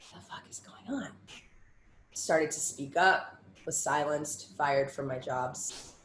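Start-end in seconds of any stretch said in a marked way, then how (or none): 0.1-1.1 whispered disbelief under the breath; shocked whisper. Retold or imitated. Judge watching little girls on stage, whispering in shock. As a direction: hiss it in stunned disbelief. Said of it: "I'm like".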